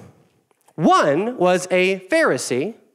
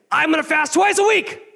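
much higher, louder bragging voice; high mocking boast; 12 semitones higher, 5 dB louder than usual. Pastor mocking the Pharisee, high and loud. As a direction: strut the words like a show-off.

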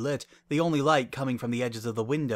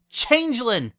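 loud, high-pitched alarmed shout; shouted accusation; 12 semitones higher, 7 dB louder than usual. A narrator's reading. Bon Bon exposing her double, shouting. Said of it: "the bonbon on the right shouted".

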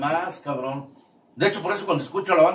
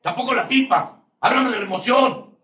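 raised, higher, louder preaching voice; heated preaching; 8 semitones higher, 6 dB louder than usual. Acted. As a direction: hammer the point home to the group like a preacher. Preaches at the group. (predicando con fuerza, más alto)

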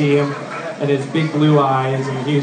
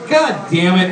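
ordinary talk, nothing marked

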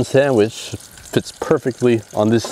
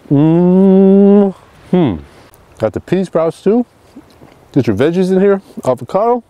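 higher, louder, chewing while talking; mouth full; 9 semitones higher, 5 dB louder than usual. Real, not acted. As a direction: talk through a mouthful of sandwich. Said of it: "I'm not supposed to talk with my mouth full".

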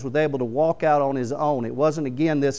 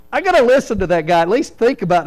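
loud, quick, higher; convinced onlooker; 6 semitones higher, 8 dB louder, faster than usual. Retold or imitated. Preacher voices a Nineveh listener, loud and quick. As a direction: blurt it out, a little scared.

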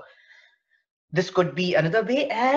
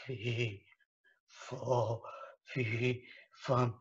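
lower, soft giant fee fo fum; 7 semitones lower, 12 dB quieter, much slower than usual. Shown as a demonstration. Teacher demoing giant voice, low and soft. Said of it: "make a voice of a giant".

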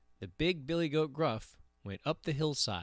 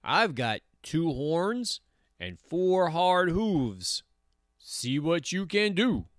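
slow, raised-pitch challenge; big billy goat; 5 semitones higher than usual. A narrator's reading. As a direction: draw out the boast as a defiant goat. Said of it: "said the billy goat Gruff".

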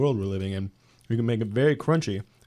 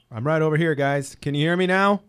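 calling out; 5 semitones higher, 6 dB louder than usual. Real, not acted. Calling out over the mic.

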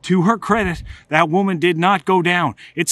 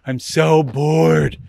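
bored whine; 4 dB louder, much slower than usual. Retold or imitated. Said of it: "sprawled out on furniture going".

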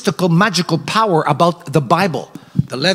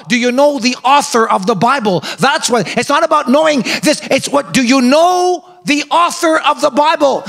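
preaching at full force; 8 semitones higher than usual. Acted. Preacher urging the church to know the Bible's author, rising to a shout. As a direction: drive the point home to the congregation. Preaching climax, loud and high.

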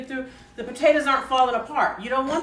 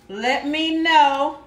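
very slow, louder "Let me know"; drawn-out ask; 3 semitones higher, 6 dB louder, much slower than usual. Real, not acted. Cook asking viewers to comment, very slow and louder. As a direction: stretch it out, inviting replies.

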